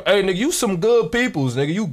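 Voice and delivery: ordinary delivery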